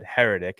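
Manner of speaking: Heretic with stress on HER; correct pronunciation. Retold or imitated. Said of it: "Did he just pronounce that wrong".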